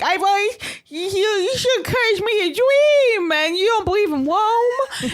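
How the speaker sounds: hammed-up tearful whine; mock hysterical crying. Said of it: "And crying hysterically".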